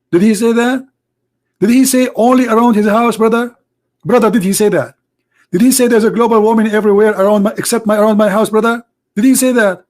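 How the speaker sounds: high, mocking "brother" voice; mock indignant questioning. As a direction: ham up an outraged debater. Mock outraged questioning, raised pitch.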